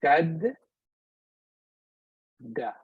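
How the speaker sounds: shadda broken as "shad", long pause, "da"; split at shadda. Shown as a demonstration. Teacher showing how to think of shadda, split into syllables. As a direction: hold apart shad- and da so both d's count. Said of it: "you will go sh".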